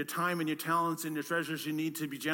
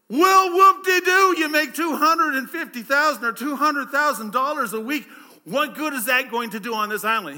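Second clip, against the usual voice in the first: sarcastic whoop-de-doo; 8 semitones higher, 11 dB louder than usual. Acted. Panicked castaway sneering at his rich friend, high and loud. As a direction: scoff at his money. Panicked man mocks back.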